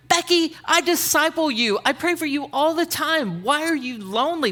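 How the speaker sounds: a half-voiced yell at a girl he mentors; imagined yell. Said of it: "and I wanna yell".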